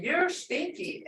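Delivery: high, drawn-out tease on stinky; playful teasing. Retold or imitated. Grandmother teasing small grandkids.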